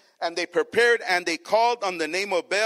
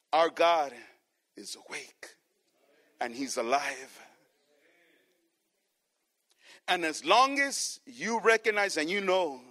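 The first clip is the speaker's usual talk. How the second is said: lower, much softer preaching voice; tender reassurance; 7 semitones lower, 6 dB quieter than usual. Real, not acted. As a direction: reassure the church gently.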